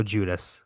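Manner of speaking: "Judas" echoed as JOO-dis; American pronunciation repeated. Shown as a demonstration. Host checking the American version, anglicized pronunciation. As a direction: say "Judas" the plain English way.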